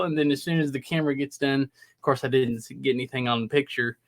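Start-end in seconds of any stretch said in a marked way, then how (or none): none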